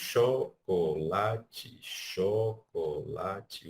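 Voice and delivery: "chocolate" drawn out syllable by syllable; slow syllable count. Shown as a demonstration. Teacher spelling out "chocolate" in slow beats. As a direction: stretch "chocolate" slowly. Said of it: "O xó e o lá, eles têm uma duração um pouquinho levemente maior".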